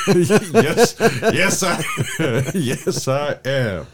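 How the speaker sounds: high, loud laughing agreement; laughing yes. Real, not acted. Caught misreading acidity, laughing it off. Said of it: "You're putting emphasis on the wrong syllable".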